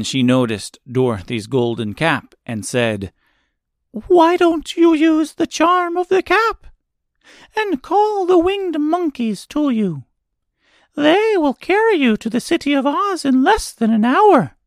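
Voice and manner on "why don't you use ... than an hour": very high-pitched mouse queen; squeaky little voice. A narrator's reading. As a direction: advise them in a tiny mouse squeak. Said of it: "in her squeaky little voice".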